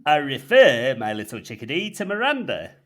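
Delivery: put-on a loud stab at a Yorkshire accent that slips; failed hammed-up Yorkshire retort; gruff North Yorkshire. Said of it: "the jizz mopper retorts in his gruff North Yorkshire tone".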